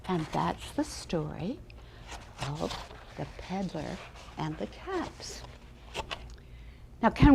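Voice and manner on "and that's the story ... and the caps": lively storybook reading for a class; reading to children. A narrator's reading. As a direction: read it like a storyteller to little ones. Teacher reads picture book aloud.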